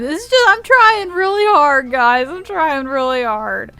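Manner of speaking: high, loud pleading that eases off; plaintive protest. Real, not acted. Streamer pleading with viewers about his effort, high plaintive protest.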